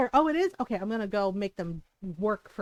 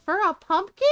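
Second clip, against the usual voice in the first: loud, high outraged question; shocked disbelief; 10 semitones higher, 10 dB louder than usual. Real, not acted. Gamer balking at pumpkin price, shocked disbelief.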